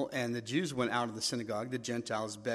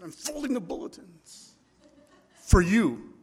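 higher, louder, laughing protest; indignant self-justifying; 7 semitones higher, 10 dB louder than usual. Retold or imitated. Young pastor answering God, higher and huffily laughing. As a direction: defend yourself with a put-upon laugh. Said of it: "I said".

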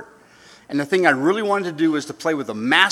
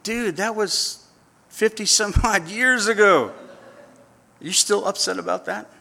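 incredulous teasing; 6 semitones higher than usual. Pretend. Voice of reason teasing.

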